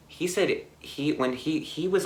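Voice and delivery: usual speaking voice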